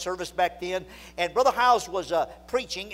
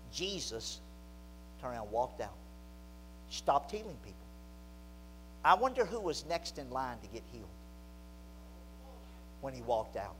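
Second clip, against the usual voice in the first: low, quiet voice with long pauses; hushed, sober; 6 semitones lower, 10 dB quieter than usual. Real, not acted. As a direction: let the point sink in softly. Sobering point after the story.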